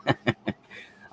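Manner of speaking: real chuckle breaking into the words; laughing. Real, not acted. Guest explaining why he finds his own remark funny, laughing. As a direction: start with a laugh, then explain. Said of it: "I'm laughing because".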